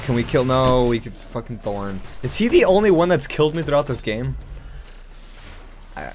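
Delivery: normal talking voice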